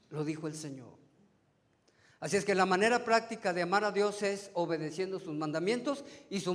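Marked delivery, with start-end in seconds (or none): 0.1-0.9 softer echo. Shown as a demonstration. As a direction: repeat it softer and lower, letting "Lo dijo el Señor" sink in. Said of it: "¿Recuerdan?". (eco más suave y más grave)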